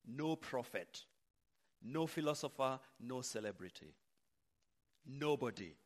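usual speaking voice

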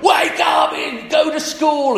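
put-on slow, high shout to a sleeping teen; mock shout. Parent yelling at a teenager to get up, loud mock shout. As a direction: yell them awake for school. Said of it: "you can be shouting your head off".

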